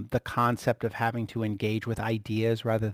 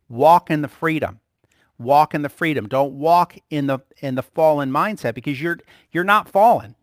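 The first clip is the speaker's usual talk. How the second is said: loud, driving sermon delivery; fervent preaching; 7 dB louder than usual. Acted. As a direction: preach it out with force. Preaching at full force.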